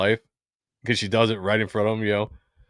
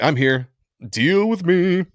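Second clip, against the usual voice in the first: bossy challenge; 7 semitones higher, slower than usual. Retold or imitated. Acts out her power move.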